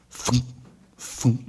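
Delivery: soft, low thumps; imitated falling rocks. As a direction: mimic rocks dropping into sand. Imitates rocks dropping in sand.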